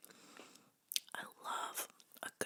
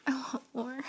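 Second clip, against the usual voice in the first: louder and higher, full voice; voiced out loud; 4 semitones higher, 8 dB louder than usual. Real, not acted. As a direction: say it out loud, not whispered. Voiced repeat after whisper.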